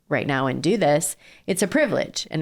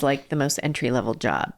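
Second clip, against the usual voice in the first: ordinary delivery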